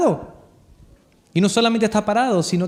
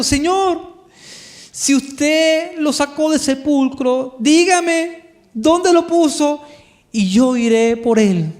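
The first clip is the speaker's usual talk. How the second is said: pleading María; 7 semitones higher, 3 dB louder, much slower than usual. Acted. María weeping at the tomb.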